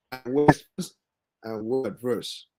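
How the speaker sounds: faint, distorted, cutting out; garbled over a weak line; bad connection. Real, not acted. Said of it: "The internet is bad".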